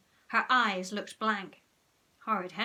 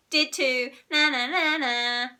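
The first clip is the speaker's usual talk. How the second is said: loud, high playground jeer; gloating sing-song; childish taunt; 5 semitones higher, 9 dB louder than usual. A narrator's reading. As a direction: chant it to tease.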